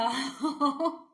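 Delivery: short amused laugh. Real, not acted. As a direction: laugh at your own bit. Laughs at own bit.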